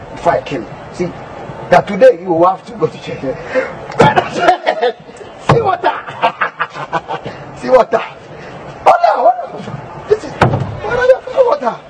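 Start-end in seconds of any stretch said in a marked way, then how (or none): 3.2-11.8 excited high exclaiming. Acted. Pastor excitedly shows water.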